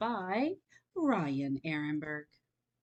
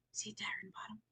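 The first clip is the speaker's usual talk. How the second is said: whisper; 2 semitones higher, 14 dB quieter, much faster than usual. Real, not acted. Reader pointing out the frog to children, whispering. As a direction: whisper it like a shared secret.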